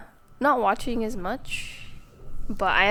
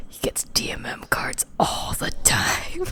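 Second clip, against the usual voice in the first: slow, conspiratorial stage whisper; mock gossip whisper; 8 semitones higher, slower than usual. Townsperson gossiping about the man buying cards, mock whisper. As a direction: whisper it slowly, like scandalised gossip.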